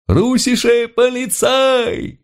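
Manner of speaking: very high, drawn-out cheer; rapturous crowd cheer. A narrator's reading. German crowd greets the Russian police, rapturous high cheer. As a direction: cry it out long and high like a delighted crowd. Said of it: "восторженно взорвалась толпа".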